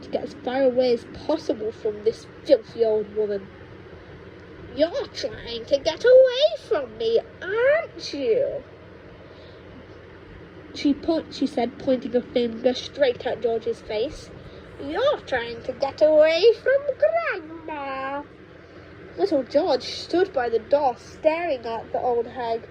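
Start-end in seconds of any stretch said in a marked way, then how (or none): 4.8-8.6 higher, louder, slow sly accusation; witchy grandma. Acted. 14.8-18.2 higher, louder, slow sly accusation; witchy grandma. Acted.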